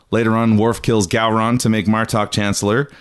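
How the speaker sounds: ordinary talk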